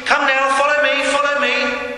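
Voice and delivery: shepherd calling out. Acted. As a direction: call the sheep in from across the pen. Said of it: "and they call out".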